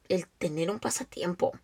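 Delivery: sudden hushed whisper for emphasis. Real, not acted. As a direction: drop to a whisper as if sharing a truth. Emphatic point dropped to whisper. (susurro enfático)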